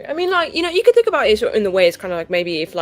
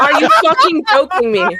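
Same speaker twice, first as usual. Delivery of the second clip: laughing outrage; 6 semitones higher, 7 dB louder than usual. Real, not acted. Hit again, laughing outrage.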